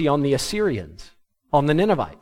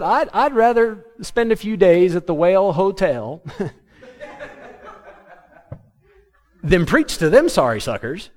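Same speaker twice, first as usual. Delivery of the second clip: higher, laughing sarcastic Jonah; laughing sarcasm; 4 semitones higher than usual. Retold or imitated. Jonah refusing to preach to Nineveh, laughing sarcasm. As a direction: joke it through a laugh. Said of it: "So Jonah said".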